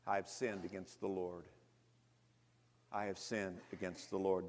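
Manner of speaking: low and soft, a hushed confession; David quietly admits his guilt before God; contrite confession. A narrator's reading. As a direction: say it twice like a broken man owning his sin.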